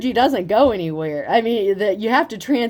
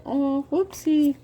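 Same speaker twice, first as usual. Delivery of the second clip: higher, slow fake oopsie; sarcastic little oops; mock innocence; 7 semitones higher, much slower than usual. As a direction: pretend sweet innocence.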